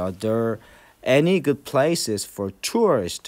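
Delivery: very slow, word by word; slow model reading. Shown as a demonstration. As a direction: read it out slowly for the class. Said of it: "전치사에는 강세가 없습니다". (아주 천천히 또박또박 읽기)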